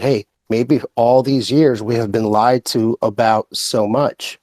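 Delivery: normal talking voice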